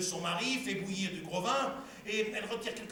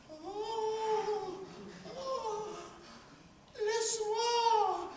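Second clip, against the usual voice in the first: wife's pleading voice; 10 semitones higher, 3 dB quieter, much slower than usual. Acted. [name] voices the wife, pleading.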